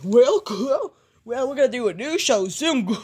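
goofy cartoon voice breaking into a laugh. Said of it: "Hi, Goofy and Donald".

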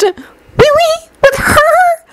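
high, shrill shocked gasp; astonished cry. A narrator's reading.

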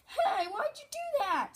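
squeaky-high pretend complaint; high toy-engine whine of complaint; high-pitched protest.

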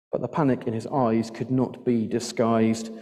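plain reading voice

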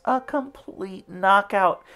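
plain reading voice

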